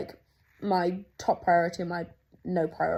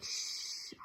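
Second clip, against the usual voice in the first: 1 hushing; 13 dB quieter than usual. Retold or imitated. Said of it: "I was like".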